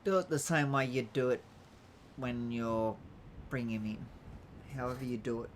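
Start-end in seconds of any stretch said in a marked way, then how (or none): none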